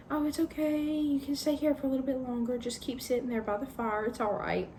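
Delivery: high, coaxing voice urging her to stay; lightly voiced fawn pleading gently; coaxing Tumnus. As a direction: sweet-talk her into staying by the fire.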